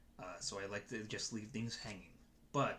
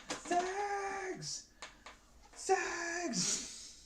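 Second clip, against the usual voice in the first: very high, drawn-out mock cry; silly squeal. Streamer clowning after a laugh, silly high squeal.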